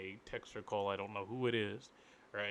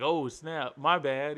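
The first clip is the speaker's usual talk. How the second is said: higher, louder caught-out apology; caller apologizing; 5 semitones higher, 9 dB louder, slower than usual. Retold or imitated. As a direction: play the caller caught out, loud and high.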